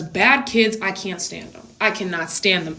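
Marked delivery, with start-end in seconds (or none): none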